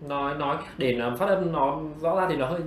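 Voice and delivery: usual speaking voice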